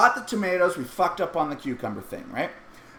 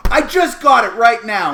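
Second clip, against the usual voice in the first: louder, higher defensive protest; 5 semitones higher, 11 dB louder than usual. Retold or imitated. Dad defends the soggy sandwich to his daughter: loud protest. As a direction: insist loudly it's fresh.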